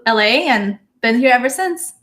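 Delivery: normal talking voice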